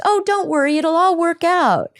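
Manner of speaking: very high, loud, airy reassurance; breezy dismissive parent. Retold or imitated. Parent brushing off a worried child, very high and breezy. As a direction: wave the worry away brightly. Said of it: "parent dismissing child's worry".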